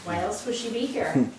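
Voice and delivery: usual speaking voice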